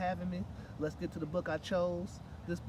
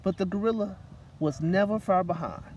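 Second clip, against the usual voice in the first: put-on reading to children; 4 semitones higher, 8 dB louder than usual. A narrator's reading. Reading a picture book aloud to children at story time. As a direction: read it aloud to a room of kids.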